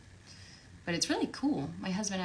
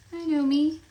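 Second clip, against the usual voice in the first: higher and louder, mock indignant; 8 semitones higher, 11 dB louder than usual. Real, not acted. Recognizing her own character's name, loud mock indignation. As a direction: announce it like it's obvious. Repeated loudly after a quiet take.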